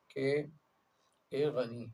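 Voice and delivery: ordinary talk, nothing marked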